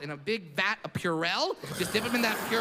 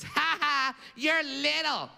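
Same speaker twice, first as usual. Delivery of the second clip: put-on higher, taunting little kid's "ha ha"; sing-song schoolyard taunt, pitched up; mocking kid; 6 semitones higher, much slower than usual.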